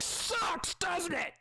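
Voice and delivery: very high, squeaky mocking creature voice. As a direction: taunt its hurt friend in a squeak. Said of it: "the other aircock is like".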